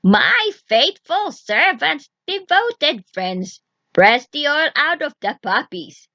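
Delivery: slow, drawn-out summoning call; witch summoning servants. Acted. Witch summoning invisible hands, slow and commanding. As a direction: call your unseen servants slowly and grandly. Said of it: "Then she cried".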